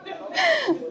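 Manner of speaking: squeaky high giggle; quick high giggle after the act; high laugh. Real, not acted. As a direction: burst of high-pitched laughter. Laughs at own perky act.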